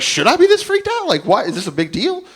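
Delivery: high-pitched mock panic; acting out a panicky patient, high voice; freaked out. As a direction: question it in rising alarm. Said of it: "I was starting to get freaked out".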